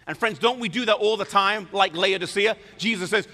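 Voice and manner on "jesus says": ordinary talk, nothing marked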